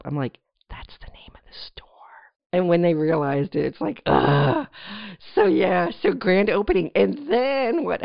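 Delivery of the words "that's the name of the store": soft whispered answer; whispered reply. Retold or imitated. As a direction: whisper it like a secret. Retelling her answer to customers.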